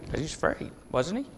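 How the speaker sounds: hushed and breathy; whispered aside. Real, not acted. The preacher hushes to a whisper about Peter's fear. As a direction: drop to a whisper for the point.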